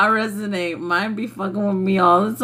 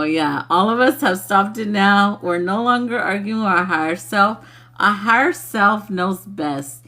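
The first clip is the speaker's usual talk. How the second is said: ordinary talk, nothing marked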